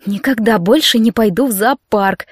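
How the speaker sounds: plain reading voice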